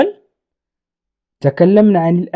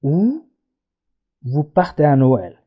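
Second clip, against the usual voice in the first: lower, softer, slow model phrase; slow and soft demo; 4 semitones lower, 5 dB quieter, much slower than usual. Shown as a demonstration. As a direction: read it gently and slowly. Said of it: "ونقول". (نطق هادئ وبطيء)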